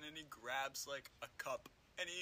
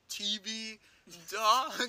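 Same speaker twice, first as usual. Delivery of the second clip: higher, loud mock yell; mock scream of disconnected words; imitated random screaming; 5 semitones higher, 9 dB louder, much slower than usual. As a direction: blurt it out like random screaming, building louder. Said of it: "just hearing her scream".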